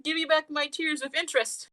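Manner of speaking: lightly acted high-pitched complaint; mock indignant demand. Imitating her wanting her tears back, mild mock indignation.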